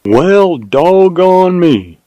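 high, drawn-out Texan astonishment; mild surprise. A narrator's reading. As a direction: stretch it out, pleasantly amazed. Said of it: "exclaimed Jim in mild surprise".

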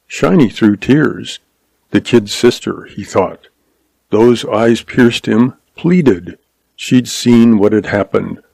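usual speaking voice

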